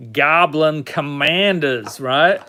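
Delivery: put-on American accent, drawn out slowly; exaggerated slow American vowels; fake American accent. Shown as a demonstration. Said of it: "my fake American accent".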